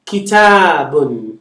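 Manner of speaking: Kitabun drawn out, every syllable clear; Kitabun, ki-taa-bun; slow model word. Shown as a demonstration. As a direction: teach Kitabun slowly, voicing the final bun. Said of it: "a book".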